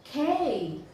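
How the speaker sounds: K said alone as a sharp loud burst; isolated consonant sound. Shown as a demonstration. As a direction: spit out the K sound on its own. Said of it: "It's letter".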